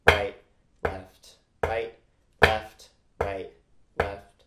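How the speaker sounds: loud called sticking over drumming; sticking called aloud. Shown as a demonstration. Teacher calling the sticking over the beat, loud chant. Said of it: "I'm gonna say out loud what the sticking is".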